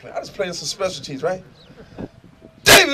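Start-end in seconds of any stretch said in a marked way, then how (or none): 2.6-2.9 sharp high shout across the field; loud, high coach's yell; coach's scream. Retold or imitated. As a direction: scream the name like an angry coach. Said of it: "so he screams".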